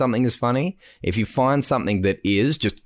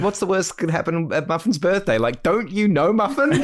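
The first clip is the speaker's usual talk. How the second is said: higher, lightly teasing disbelief; mock exasperated fan; 8 semitones higher than usual. Fan teasing Bandit about Muffin, light mock exasperation. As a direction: scold the cartoon dad playfully.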